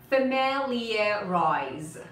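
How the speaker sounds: "Familiarize" stretched out extremely slowly; slow model pronunciation. Shown as a demonstration. Teacher models a hard word for repetition, extremely slow pronunciation. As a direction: draw every sound of "Familiarize" right out. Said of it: "try to repeat after me".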